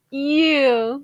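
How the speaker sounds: loud mocking "Ew"; a loud teasing groan of disgust at the couple; friends going ew. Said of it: "have our friends go".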